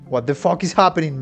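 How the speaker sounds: ordinary talk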